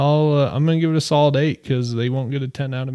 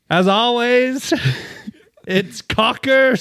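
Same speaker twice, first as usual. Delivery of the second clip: higher, slow Aussie read with laughter; best Australian accent; 7 semitones higher, slower than usual. Acted. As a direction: attempt the slang in mock Aussie, amused. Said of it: "Best Australian accent?".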